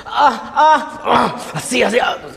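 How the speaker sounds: high breathy-sounding mock moaning; mock porn moans. Shown as a demonstration. Voice actor demoing porn dub moans.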